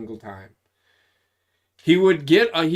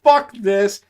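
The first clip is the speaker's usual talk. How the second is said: fed-up sergeant; 6 semitones higher, 10 dB louder than usual. Retold or imitated. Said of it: "the drill sergeant was like".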